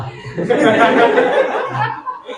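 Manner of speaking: high-pitched laughing; laughter. Real, not acted. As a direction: burst into a long, high laugh. Teacher and class laugh at joke. (ऊँची हँसी)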